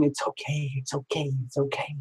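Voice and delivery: very soft, soothing murmur; imitating gentle, quiet comforting; hushed reassurance. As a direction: comfort someone under your breath. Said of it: "Rubbing his shoulder and going".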